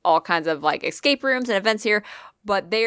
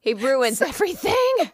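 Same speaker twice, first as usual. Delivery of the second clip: laughing; 7 semitones higher than usual. Real, not acted. Exasperated laughing outburst.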